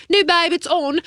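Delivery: exaggerated British vowels on a casual quote; Prince Harry. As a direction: put on a playful British accent. Redoes Prince Harry's line in his accent.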